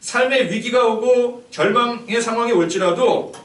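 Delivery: high, loud preaching push; forceful preaching. Acted. Pastor preaching at full force to the church. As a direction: drive the truth home to the congregation.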